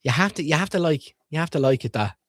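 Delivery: ordinary delivery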